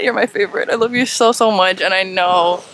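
normal talking voice

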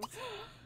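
quick high intake of breath; sudden high gasp; high excited gasp. Real, not acted. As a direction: gasp in delight. Idea of a tattoo.

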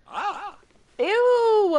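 loud, high ew of disgust. Real, not acted. Player reacting to a stinky sim, loud disgust. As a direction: recoil at the smell.

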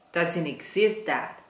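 ordinary talk

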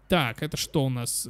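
normal talking voice

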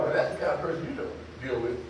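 normal talking voice

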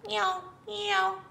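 high mewing cat sound; tomcat meow. Acted. Reading to a child, the tomcat meows high. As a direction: mew like a tiger tomcat.

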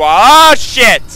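loud, high yelp into a curse; startled curse. Real, not acted. Player hit by a mishap, startled curse. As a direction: cry out as it goes wrong.

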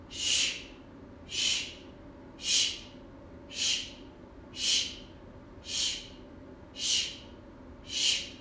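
long soft unvoiced shh, over and over; funny shushing noise. Shown as a demonstration. Teacher shushing for the kids, soft unvoiced shh. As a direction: hush like a washing machine swishing. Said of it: "help me make a really funny noise".

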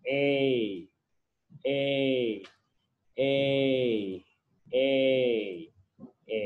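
"A" stretched into a sustained "ay"; long A. Shown as a demonstration. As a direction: repeat "A" as a drawn-out vowel, with gaps between. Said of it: "long A sounds". (ออกเสียง "A" ลากยาวทีละครั้ง)

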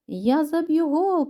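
high, slow storybook cry; excited shout. A narrator's reading. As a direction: call it out slowly with a lifted voice. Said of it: "кричить Теса". (вигук, високо й протяжно)

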